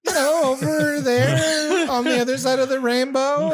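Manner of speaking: high laughing Irish lilt; Irish accent. Pretend. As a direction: laugh through a fake Irish tale. Mock Irish.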